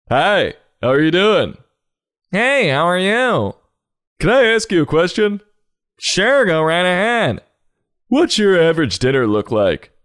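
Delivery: high, put-on chirpy greeting; sing-song small-talk voice, high; over-friendly strangers. Acted pitch between smiling strangers.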